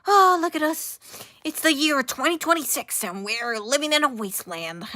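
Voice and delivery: high, loud sarcastic parody of self-pitying characters; hammed-up mock preachy voice; mocking show voice. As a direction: mimic the show as smug and whiny. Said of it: "It's like".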